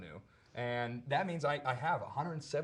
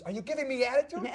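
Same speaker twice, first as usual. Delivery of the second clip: high, raised-voice challenge; yelling cop; 12 semitones higher, 6 dB louder than usual. Retold or imitated. Cop challenging the actor over attitude, yelling. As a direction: imitate a cop demanding respect, loudly. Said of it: "a cop across the street yelling at me".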